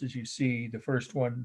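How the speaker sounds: ordinary delivery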